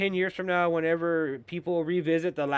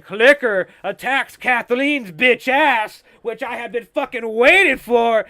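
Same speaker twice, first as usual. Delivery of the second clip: loud, high gloating outburst; gleeful shout; 6 semitones higher, 11 dB louder than usual. Real, not acted. Viewer loudly gloating as the villain finally gets attacked. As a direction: cheer it out like you waited all episode.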